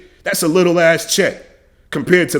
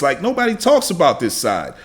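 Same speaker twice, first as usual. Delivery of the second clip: usual speaking voice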